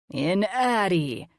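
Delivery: very slow, drawn-out silly monster voice; funny voice. Acted. Colin playing mad scientist to the kids in a funny voice. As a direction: stretch it out like a cartoon mad scientist.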